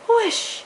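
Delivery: high, loud whoosh of magic. A narrator's reading. Fairy's wand turning the pumpkin, a bright loud whoosh. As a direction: sweep the sound up bright and fall.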